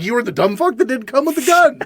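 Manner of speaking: high-pitched mock indignation; imitated exasperated retort; retold angry comeback. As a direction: fire back in outrage, half laughing. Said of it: "I was not happy".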